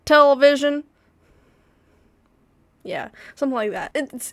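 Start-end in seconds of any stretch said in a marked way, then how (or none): 0.1-0.9 loud, boastful American caricature; mock American accent. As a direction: ham up a loud American good old boy accent. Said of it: "I'm tired of America".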